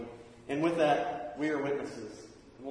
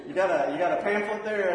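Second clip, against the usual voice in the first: loud, higher, laughing through it; calling out with a laugh; 4 semitones higher, 6 dB louder than usual. Retold or imitated. Man on the toilet asking the teen for his pamphlet, laughing. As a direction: call out, amused.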